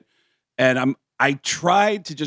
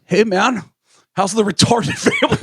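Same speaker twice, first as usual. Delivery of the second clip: high, laughing mock [name] voice; a fake line in a high [name] voice, cracking up; [name] joking; 9 semitones higher, 3 dB louder than usual. Teller mimics drummer [name] greeting the family, high and laughing. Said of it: "he goes".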